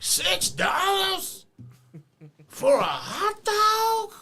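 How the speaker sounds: very high, slow, loud outraged yell; Weasel voice. Retold or imitated. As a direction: stretch it out in a high shriek. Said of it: "it'll have the voice in it for you".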